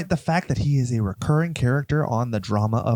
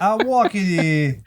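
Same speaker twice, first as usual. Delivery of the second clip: put-on New York deli accent; 5 semitones higher, slower than usual.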